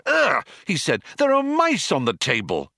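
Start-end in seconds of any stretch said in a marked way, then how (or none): 0.1-0.5 high, loud yelp of disgust; startled yelp. A narrator's reading. Arthur spots mice on the table, yelping. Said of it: "suddenly yelped". 1.2-2.7 high-pitched alarmed outcry; yelped alarm. A narrator's reading. Arthur spots mice at lunch, alarmed yelp. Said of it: "suddenly yelped".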